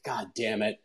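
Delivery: low, soft muttered curse to himself; muttered under breath. Retold or imitated. Muttering as the big guys run over, quietly resigned. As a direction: grumble it quietly, dreading the brawl.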